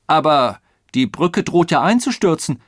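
loud, high protest. A narrator's reading. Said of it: "protestierte der Zugführer". (laut protestierend)

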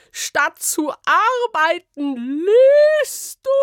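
shrill, very high, loud outrage; furious scolding. Acted. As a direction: screech at her in outrage. (kreischend empört, sehr hoch und laut)

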